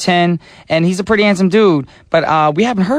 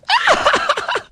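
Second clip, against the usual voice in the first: high shrieking mock laugh of mom; mom's laugh. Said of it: "And my mom goes".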